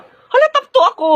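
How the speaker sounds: very high, excited squeal of words; thrilled exclamation. Acted. Student sees own rank on honors list, high excited cry. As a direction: cry it out high with delighted surprise.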